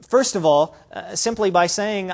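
ordinary delivery

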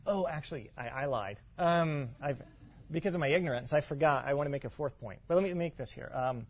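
usual speaking voice

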